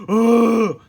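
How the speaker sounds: a starfish crashing into cans, crying out; pained cry. Acted. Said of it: "cried Patrick".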